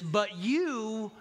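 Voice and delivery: high, stretched mock protest; excuse-making. Apologizing spouse adds a qualification, high excuse-making protest. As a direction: slide up into a blaming excuse. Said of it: "you gotta leave the "but".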